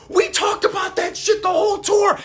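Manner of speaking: very high, loud excited yell; excited shout. Retold or imitated. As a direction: shout it out, thrilled. Friend excitedly recalls the moment.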